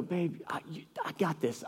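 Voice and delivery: very soft, soothing dad; calm the child under your breath; hushed reassurance. Retold or imitated. Dad quietly reassures his daughter.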